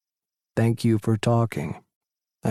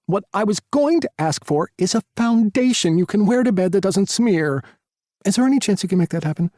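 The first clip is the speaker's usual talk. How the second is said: super sassy Tyler; 6 semitones higher than usual. A narrator's reading. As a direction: gush like a sassy friend on the phone. Tyler's requests to God.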